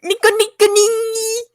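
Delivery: cutesy anime mimicry; anime catchphrase. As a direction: chirp it playfully up high.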